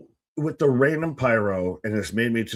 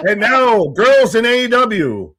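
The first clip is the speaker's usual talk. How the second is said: booming, high announcer voice, drawn out slowly; game show announcer; 9 semitones higher, 8 dB louder, slower than usual. Retold or imitated. Host introducing girls to the crowd, booming announcer. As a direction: hype it like a cheesy TV host.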